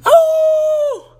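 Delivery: a high startled cry of fright; frightened cry. Acted. Children hear wolves howl again, a high frightened cry.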